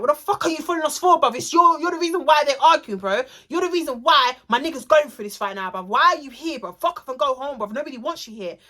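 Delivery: fast-starting, high-pitched acted tirade; angry rebuke. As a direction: chase someone off, retelling it heatedly. Friend acts angrily at follower.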